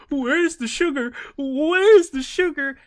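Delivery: high, loud, stammering mock old man; confused old man. Old shopper confused and demanding sugar, high and stammering. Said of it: "It's like".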